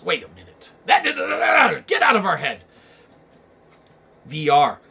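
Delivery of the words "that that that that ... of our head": panicked shout. Acted.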